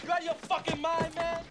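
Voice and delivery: loud, high, furious challenge; angry yell. Acted. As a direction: bark it in his face. Cop confronting bar patron.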